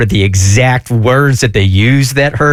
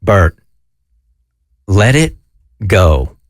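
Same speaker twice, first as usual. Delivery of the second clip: slow, spaced-out voice in the head, falling low on each phrase; lightly voiced inner voice, measured and slow; inner voice speaking; 4 semitones lower, slower than usual. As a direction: let each phrase drop low, with long pauses between. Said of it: "I hear a voice saying".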